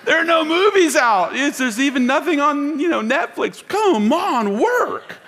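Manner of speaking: high and loud, laughing through it; pushing the joke while laughing; laughing exclamation. Real, not acted. Joking, laughing about movies.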